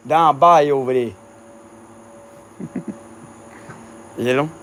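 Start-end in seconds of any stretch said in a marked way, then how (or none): 0.1-1.1 imitating a Cajun elder; mock Cajun accent. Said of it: "Like that".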